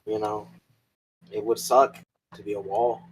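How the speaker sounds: ordinary talk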